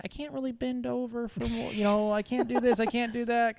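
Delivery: put-on high frail old lady voice; lightly mock a frail complaint; imitating old lady. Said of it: "She's like".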